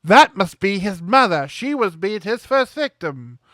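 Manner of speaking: hammed-up British accent, loud at the start; Hayley Mills accent. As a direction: put on a bad British accent, drawn out at the end. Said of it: "I apologize for the accent".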